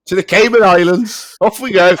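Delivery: loud, high mock-jubilant cry; jubilant send-off. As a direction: call out a gleeful getaway. Joking about fleeing with the money.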